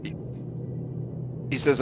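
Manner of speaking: usual speaking voice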